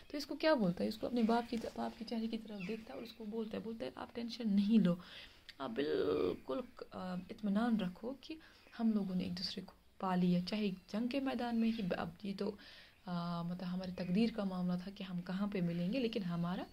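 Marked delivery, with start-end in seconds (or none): none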